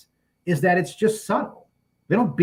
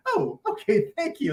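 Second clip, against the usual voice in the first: polite reply; 13 semitones higher than usual. Retold or imitated. Guest politely accepting the advice, high mock politeness. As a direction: chirp a prim little thank you. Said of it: "And she's just like".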